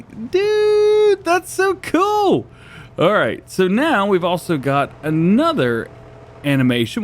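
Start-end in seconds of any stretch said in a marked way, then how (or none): 0.0-2.5 excited high dude voice. Real, not acted. Excited reaction to the tractor.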